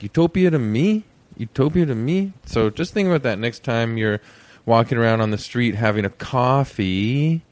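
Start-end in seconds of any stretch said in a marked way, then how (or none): 0.0-2.3 De Niro style title. Shown as a demonstration. Host showing listeners how to say the title, De Niro style. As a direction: say the show name like "You talking to me?". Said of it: "So you gotta be like".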